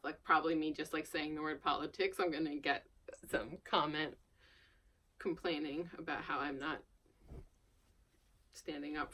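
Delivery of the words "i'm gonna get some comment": ordinary delivery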